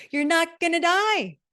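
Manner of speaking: laughing high and loud on die. Real, not acted. Podcaster laughing to listeners, high and loud. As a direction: laugh it out, pitch jumping way up.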